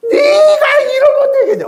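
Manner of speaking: high, loud scolding yell. Retold or imitated. Father shouting high at his child, scolding. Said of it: "언성이 높아지거든".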